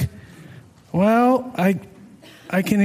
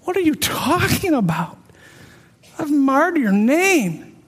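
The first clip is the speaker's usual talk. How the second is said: distraught disbelief; 6 semitones higher than usual. Retold or imitated. Protesting his guilt to God.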